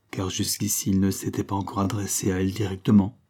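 ordinary delivery

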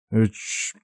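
short, soft disgruntled grunt; displeased grumble. A narrator's reading. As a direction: grunt quietly, mildly annoyed. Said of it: "недовольно проворчал Томми".

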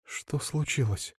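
soft, slow and feeble; weak voice. Acted. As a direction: ask faintly, barely able to speak. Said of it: "слабый голос Василисы".

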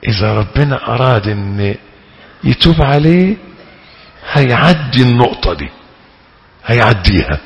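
ordinary talk, nothing marked

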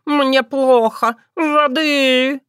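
high, slow, hammed-up faintness; swooning cat. A narrator's reading. Cat clutching his heart, melodramatic swoon. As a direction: moan theatrically for help. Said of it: "Баюн схватился за сердце".

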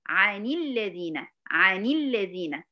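"عن الذين" slowly, nun opened with kasra as "ʕanilladhina"; correct linked reading. Shown as a demonstration. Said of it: "حركت الساكن الأول".

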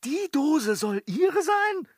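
high, loud outraged protest; indignant shout. Acted. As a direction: shout back in disbelief. Servant protests the accusation.

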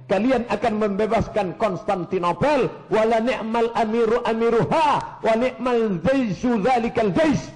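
high, forceful preaching; fervent proclamation. Real, not acted. Preacher proclaims the conquest prophecy, fervent high delivery. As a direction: proclaim it like a rallying cry.

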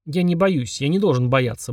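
usual speaking voice

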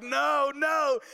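high panicked shouting; frantic yell. Retold or imitated. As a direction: yell at the dog to come back. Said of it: "And I'm yelling".

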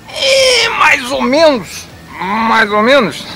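high skeptical sing-song; mocking doubt. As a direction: drag out a doubtful so-so. Mocking doubt about Pixar.

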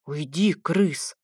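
slow, hissed angry whisper. A narrator's reading. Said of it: "зло прошептал я".

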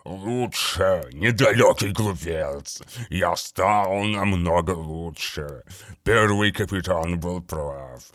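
low, slow, wet monster voice; gurgling daemon voice. A narrator's reading. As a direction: savour every word like a rotting daemon. Narrator voices the daemon Grulgar.

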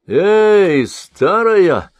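loud, high hail across the water; calling out. A narrator's reading. Rahdanit hails the old woman across the sea, loud call. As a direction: shout to the old woman from the shore. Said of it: "окликнул Рахданит".

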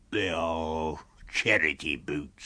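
low, very slow character voice; deep, ponderous vagrant; tramp Mr. Marvel, unbothered. A narrator's reading. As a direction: drag it out low and unhurried.

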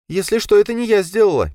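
high, squeaky little voice; squeaky Лея. A narrator's reading. Лея squeaks her innocence to the hero. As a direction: squeak it out defensively. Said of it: "пискнула Лея".

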